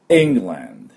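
England with the opening E pushed from the back of the throat; England, strong first vowel from the throat; throaty first E. Shown as a demonstration. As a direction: start England with a sharp throaty E. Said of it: "い、い、喉の奥で".

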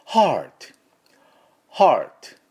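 American "heart" with the tongue curled for R; American pronunciation. Shown as a demonstration. Teacher modelling heart for learners, American pronunciation. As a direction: sigh out "haa", then curl into an American R. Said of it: "綺麗なアメリカの音になります".

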